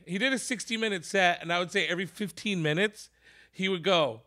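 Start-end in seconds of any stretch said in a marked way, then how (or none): none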